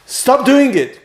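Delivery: sharp, high, loud order; high scolding command; critical. Acted.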